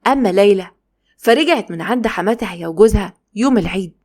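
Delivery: usual speaking voice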